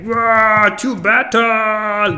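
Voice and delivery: armed snail charging onto the battlefield; battle cry. Retold or imitated. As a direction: roar the call to battle.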